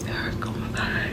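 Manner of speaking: breathless whispered aside; hushed whisper to yourself. Real, not acted. Aside to absent friend Eric.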